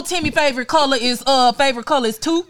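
ordinary delivery

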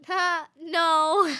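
high, loud, laughing refusal; a bright, jumpy laugh to brush off a creepy offer; nervous laughing no. Retold or imitated. Refusing a ride from a stranger.

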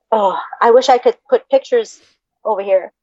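normal talking voice

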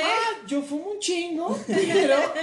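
high, slow teasing imitation of a friend; mocking a bragging friend. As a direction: lift the voice and stretch it out, poking fun. Mocking Pame bragging about smoking.

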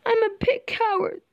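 high, lightly tearful lion; understated storybook whimper from a lion; mock crying. A narrator's reading. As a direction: confess in a high, mildly pitiful voice. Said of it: "the lion says, crying".

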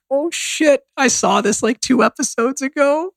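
high-pitched, sheepish dawning recognition; retold oops moment, pitched high; sudden realisation. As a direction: re-enact realising you were wrong, voice way up. Retold sudden realisation mid-argument.

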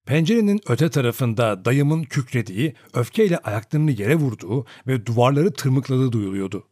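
ordinary delivery, nothing marked

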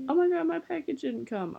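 high, fast mock valley girl. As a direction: squeal it like a ditzy teen. Mocking an annoying classmate.

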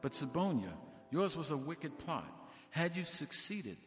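mild shocked reverend. Retold or imitated. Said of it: "and said".

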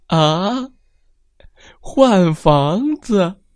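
胆小先生吃惊; startled gasp. A narrator's reading. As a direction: gasp it out in timid disbelief. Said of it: "胆小先生大吃一惊".

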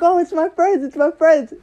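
put-on high, nervous girlfriend's excuse; nervous girl. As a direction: squeak out a guilty excuse. Girl's nervous excuse to mom.

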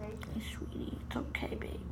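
soft whisper to a scared kitten; hushed whisper. Real, not acted. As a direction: whisper it to calm the cat.

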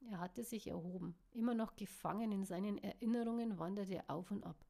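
plain reading voice

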